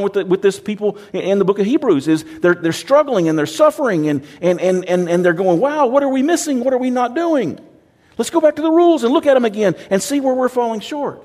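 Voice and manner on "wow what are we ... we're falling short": higher, worried searching voice; hammed-up panic over missed rules; fretting churchgoer, pitched up; mock anxious believer.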